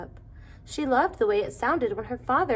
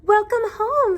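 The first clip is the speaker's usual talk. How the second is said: high, loud welcome; cheery greeting; 13 semitones higher, 9 dB louder, slower than usual. A narrator's reading. Mother welcoming daughter home, high and bright. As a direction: greet her brightly at the door.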